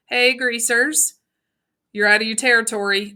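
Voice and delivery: plain reading voice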